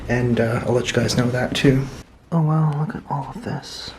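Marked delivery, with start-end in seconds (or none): 2.3-3.9 awed whisper; nervous night vlog whisper. Real, not acted. Whispered awe at exhibits.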